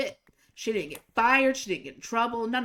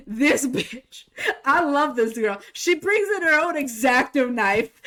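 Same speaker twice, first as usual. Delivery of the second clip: laughing delight; 3 semitones higher, 6 dB louder than usual. Real, not acted. Gleeful telling of defiance.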